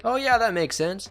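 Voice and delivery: high, sing-song fake agreement; mock the crowd nodding along, high and breezy. Commentator mocking clueless agreement, high and sing-song. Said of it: "Did you think everybody was just gonna be like".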